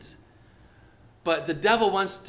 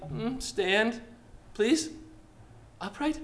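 timid, pleading, rising higher and softer; shaky, wobbly plea; 5 semitones higher, 5 dB quieter, slower than usual. Shown as a demonstration. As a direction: ask weakly, unsure it will work. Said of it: "not a shaky, wobbly".

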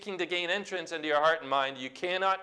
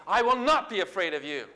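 raised, emphatic defiance; bold declaration; 4 semitones higher, 3 dB louder than usual. Shown as a demonstration. Believer alone in a closet, boldly defiant. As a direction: declare it firmly at the enemy. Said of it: "words of faith roaring".

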